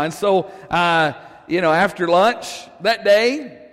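normal talking voice

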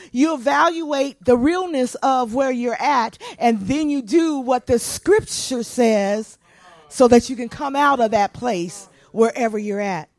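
preaching at full force, loud. Acted. Pastor preaching loud to the congregation. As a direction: drive the point home to the congregation.

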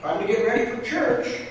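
bright, high, loud wake-up call; cheerful. Acted. Mother calling her son to get up, cheerful and high. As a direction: sing out a cheery morning call to Billy. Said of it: "she says cheerfully".

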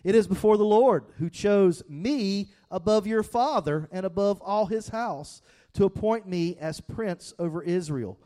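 loud, high preaching voice; preaching at full force. Acted. Pastor reading David's reply to the congregation, fervent preaching. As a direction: proclaim it to the whole room.

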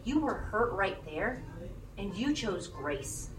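God softly telling a hurt person he is proud; gentle reassurance. Pretend. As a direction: speak pride quietly like a loving father. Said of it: "God is like".

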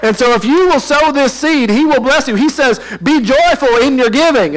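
high, loud, booming Southern revival preacher. Retold or imitated. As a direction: ham up a prosperity preacher selling the seed offering. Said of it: "this is a Southern preacher".

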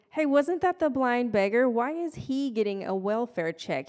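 loud, high-starting busybody; mock the neighbor's indignant questioning; nosy neighbor. Nosy neighbor gossiping about the blind beggar, mock indignant. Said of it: "now we have the nosy neighbors".

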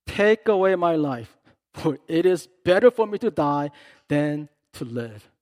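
loud, wailing plea to die; whining prophet. Retold or imitated. Jonah begging God to end his life, loud wail. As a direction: cry out to God like a sulking child. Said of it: "What a baby prophet".

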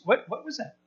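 hushed, halting curiosity; curious onlooker. Acted. Onlookers who heard a sound, quietly curious. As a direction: wonder it softly, almost to yourself.

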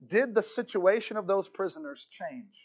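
ordinary talk, nothing marked

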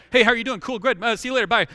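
loud, casual brush-off greeting; tossed off like a hollow hello; breezy small talk. Retold or imitated. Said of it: "they aren't just kinda like".

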